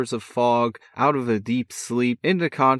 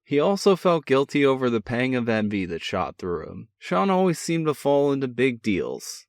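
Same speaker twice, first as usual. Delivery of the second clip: ordinary delivery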